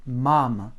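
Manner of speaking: "mam" loud on the first syllable, second almost swallowed; stressed first syllable. Shown as a demonstration. Teacher showing the Russian word for mother, first syllable stressed in "mam". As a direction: punch the MA of "mam", reduce the final vowel. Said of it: "première syllabe est très accentuée".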